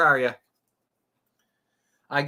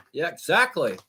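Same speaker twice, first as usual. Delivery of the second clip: ordinary talk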